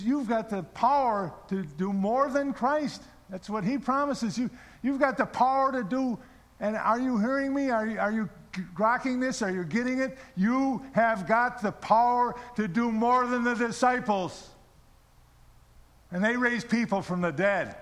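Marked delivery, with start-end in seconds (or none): 0.0-3.0 slow, raised, emphatic preaching; retold mentor in full exhortation; impassioned sermon. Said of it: "gave me a twenty-minute sermon". 4.8-14.5 high-pitched, insistent exhortation; impassioned sermon. Retold or imitated. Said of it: "gave me a twenty-minute sermon".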